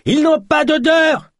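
extremely high, drawn-out shriek; piercing panic. A narrator's reading. Yosp warns of scentless men arriving, shrieking in panic. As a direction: shriek it at the top of the register. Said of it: "de plus en plus perçante".

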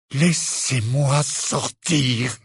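very slow, stretched-out words; slow demand. A narrator's reading. Harry repeating demand to leave, slow and deliberate. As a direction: spell it out with held-back fury.

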